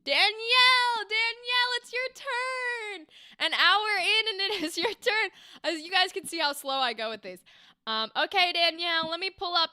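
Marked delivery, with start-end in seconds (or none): 0.0-5.3 singsong calling. Real, not acted. Teacher calling up the next student, high singsong. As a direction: call the name out in a high lilting singsong.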